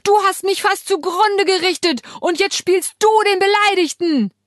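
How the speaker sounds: loud, high angry accusation; furious yell. A narrator's reading. Wife yelling at her husband on the phone, furious accusation. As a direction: hurl the accusation at him.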